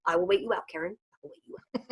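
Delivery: low, soft, unvoiced threat; menacing dog whisper. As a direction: whisper the standoff like a villain. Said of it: "they're like".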